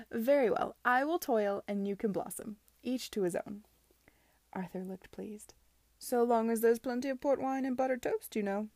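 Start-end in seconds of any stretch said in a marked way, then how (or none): 0.1-3.6 amused. A narrator's reading. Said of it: "Charlotte laughed". 6.0-8.7 loud, lifted, jovial voice; hearty Arthur, pleased. Acted.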